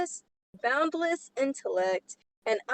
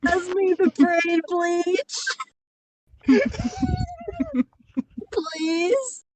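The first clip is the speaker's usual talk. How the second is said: laughing plea; 6 semitones higher, 4 dB louder, slower than usual. Real, not acted.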